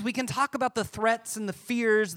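normal talking voice

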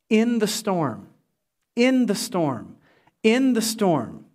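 slow, weighted repeated phrase; emphatic slow refrain; slow repetition. Real, not acted. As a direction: let each repeat sink in. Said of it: "repeated slow emphasis".

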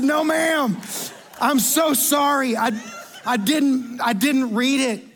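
high, emphatic mock pleading; hammed-up flustered begging for pardon; panicked apology. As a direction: blurt a denial, then stretch out the apology. Flustered customer apologises to manager.

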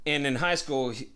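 ordinary talk

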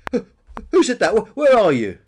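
high, loud, stuttering questions; startled stammer. A narrator's reading. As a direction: call out, rattled by a voice from nowhere. Peter hunts the unseen voice.

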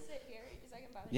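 fast soft whisper, off mic; whispered aside. Real, not acted. To the next speaker, a fast whispered aside. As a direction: slip a quick private word to a friend.

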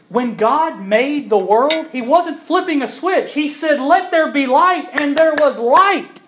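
loud, high pulpit proclamation; full-force preaching. Acted. To the congregation about creation, preached loud. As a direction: preach it out with conviction.

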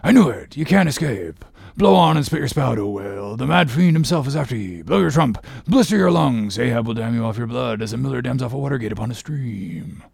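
fast, growling sailor's taunt; relishing threat, tumbling out quickly; exultant Stubb. A narrator's reading. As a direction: crow at the whale in a rushing sea-dog voice. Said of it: "cried Stubb".

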